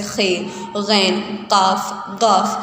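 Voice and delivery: خے، غین، قاف each said apart, slowly, from the back of the mouth; letter articulation. Shown as a demonstration. Teacher demonstrates weak sounds to students, خے، غین، قاف spaced out carefully. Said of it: "حروف کی ادائیگی".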